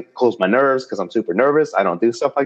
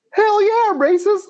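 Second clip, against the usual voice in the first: very high, put-on mock exclamation; joking high-pitched outburst. Comic fakes his answer to his date, in high joking voice. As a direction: squeal it as an obvious joke. Joke answer, then just kidding.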